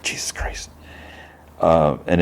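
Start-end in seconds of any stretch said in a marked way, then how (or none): 0.0-0.7 whispered curse under the breath; muttered oath. Retold or imitated. Chris caught off guard by Rick, muttered under his breath. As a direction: mutter it very softly, voiceless. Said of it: "he just muttered".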